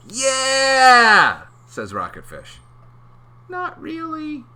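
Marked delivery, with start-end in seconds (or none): 0.1-1.4 very high, loud yeah; eager fish shout; excited cheer. Acted. Rocketfish cheering. 3.5-4.4 very high little voice; comic high clam. Acted.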